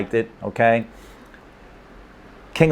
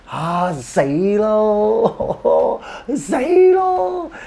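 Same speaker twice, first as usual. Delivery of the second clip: drawn-out, high groaning lament, breaking into a laugh; slow, high mock-anguished moan with a laugh; frustrated after a miss; 12 semitones higher, much slower than usual. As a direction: wail it like you just missed the winning shot. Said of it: "and you miss".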